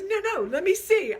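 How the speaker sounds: high, loud mock-teasing voice; teasing refusal. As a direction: imitate him gleefully holding the phone away. Retold teasing tug-of-war over phone.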